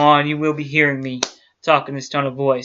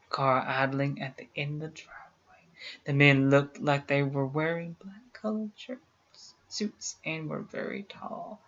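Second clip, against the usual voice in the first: hushed story narration; 8 dB quieter than usual. Acted. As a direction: read it like a ghost story told in the dark. Hushed reading of a creepy report.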